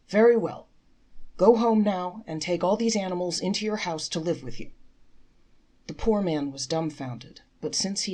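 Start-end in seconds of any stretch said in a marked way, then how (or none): 0.1-4.7 firm order. Acted. Rabbi gives strange order.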